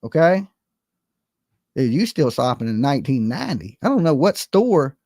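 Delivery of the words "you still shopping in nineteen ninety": usual speaking voice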